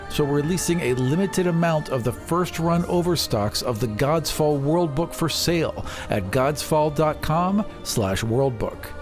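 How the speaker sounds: ordinary talk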